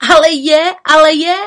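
loud high insisting call. A narrator's reading. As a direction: shout it eagerly. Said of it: "volala maska čarodějnice".

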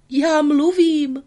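high, loud, slow astonished cry; puppet's cry. Acted. Pinocchio discovering speech cries out, high and slow. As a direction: shout it out, amazed at your own voice. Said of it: "vykřikl".

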